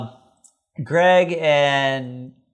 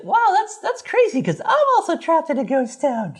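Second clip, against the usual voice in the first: put-on much higher, airy little girl voice; Cindy voiced; 14 semitones higher than usual. Cindy telling Greg she is trapped too, high girly voice. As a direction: say it like a cheerful clueless kid. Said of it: "And Cindy's like".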